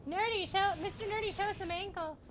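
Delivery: high, teasing call across the room. Real, not acted. Streamer calls to partner to flaunt it, playful high call. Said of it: "I just need to say it a little louder".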